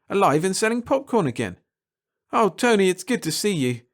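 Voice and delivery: ordinary delivery, nothing marked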